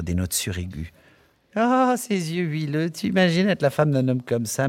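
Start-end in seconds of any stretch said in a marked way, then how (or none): 1.5-4.7 mewing. Acted. Barmaid Miss Kennedy mocking Bloom to her friend, high mewing. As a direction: mew it out, teasing and coy. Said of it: "miaulait mademoiselle Kennedy".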